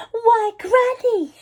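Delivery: high, slow and wondering; girl noticing something odd, slow; slow and wary. A narrator's reading. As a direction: draw it out carefully. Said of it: "she said slowly".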